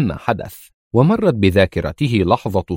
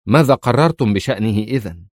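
usual speaking voice

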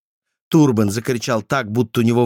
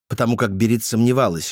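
usual speaking voice

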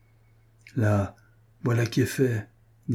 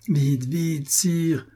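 high urgent call without real volume; understated, high-pitched cry of warning; 6 semitones higher, much slower than usual. A narrator's reading. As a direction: hurry the king along in a mock shout. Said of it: "criait la Guiche".

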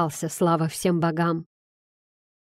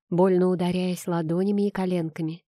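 ordinary delivery, nothing marked

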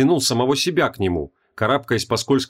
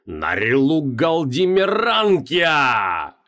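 slow ritual shout; 3 semitones higher, much slower than usual. Acted. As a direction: bellow the name, stretched out. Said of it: "кричал Креол".

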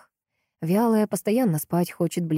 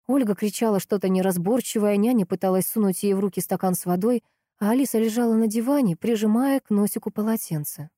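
ordinary delivery, nothing marked

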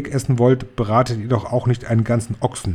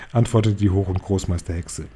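ordinary delivery, nothing marked

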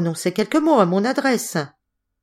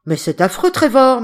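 loud, higher cry of shock; exclaiming to Trevor in shock, loud; 6 semitones higher, 7 dB louder than usual. A narrator's reading. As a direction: cry out his friend's name in alarm. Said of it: "m'écriai-je".

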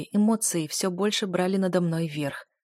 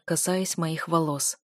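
plain reading voice

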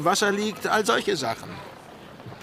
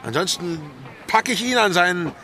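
louder, gleeful bragging threat; boisterous threat; 3 semitones higher, 5 dB louder than usual. Acted. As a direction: bellow it like a rowdy pirate. Boisterous threat about her fighter. (lautes prahlerisches Drohen)

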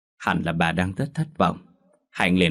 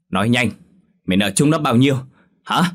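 higher and louder, demanding an answer; angry shout; 4 semitones higher, 6 dB louder, faster than usual. Acted. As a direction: bark at the daughter to speak up. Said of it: "Bà lành bực bội quát". (mẹ bực bội quát hỏi con)

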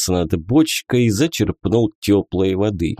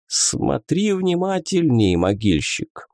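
mocking; 6 semitones higher than usual. A narrator's reading. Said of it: "с насмешкой в голосе произнесла костлявая".

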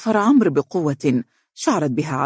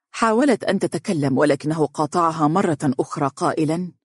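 usual speaking voice

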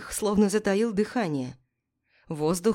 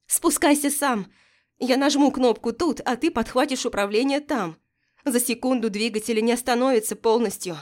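higher, louder, urgent shouting; shouting in his ear; 4 semitones higher, 3 dB louder than usual. Acted. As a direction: yell it right into his ear. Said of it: "я прокричала в ухо".

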